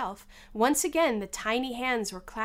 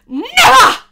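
furious high shout; one very loud, enraged outburst; 20 dB louder than usual. A narrator's reading. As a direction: explode on the word at full force. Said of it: "said the queen furiously".

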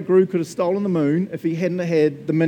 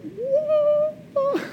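very high, drawn-out pretend scream; mock scream. Preacher reacting to God's empowering, high mock scream. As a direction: squeal it high, not really loud. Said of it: "That's me screaming loud".

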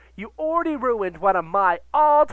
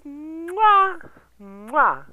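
air kisses; 5 semitones higher than usual. Real, not acted. Blowing kisses.

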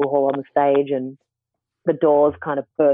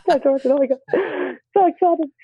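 laughing excitedly; 12 semitones higher than usual. Real, not acted. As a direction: laugh through the words, thrilled. Recalls meeting her idol.

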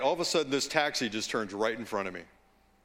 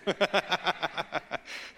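laughing; 4 semitones higher than usual. Real, not acted. Laughing at own slip.

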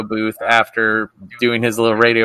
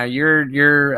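ordinary talk

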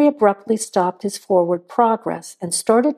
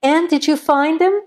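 higher, louder call from a distance; calling from outside; 7 semitones higher, 3 dB louder than usual. A narrator's reading. Laura calls to Anne from outside, raised. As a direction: call out across the yard. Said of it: "Laura's voice called from somewhere outside".

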